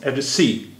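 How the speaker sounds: Address सी with सी strongest, first syllable lighter; primary stress on सी. Shown as a demonstration. Teacher demonstrating primary and secondary stress, सी stressed most in address सी. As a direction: lean hardest on the final सी in address सी. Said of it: "सी पर ज़्यादा जोर दिया".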